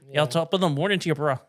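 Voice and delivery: put-on a light Irish accent, barely shifted; a hint of an Irish accent from TV; mock Irish accent. Friend showing off his Irish accent learned from Sons of Anarchy. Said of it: "my Irish accent down cold".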